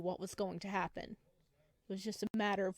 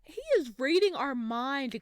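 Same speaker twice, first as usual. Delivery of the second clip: louder and excited; 5 semitones higher, 8 dB louder than usual. Real, not acted. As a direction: exclaim it, amazed. Reacting to game character.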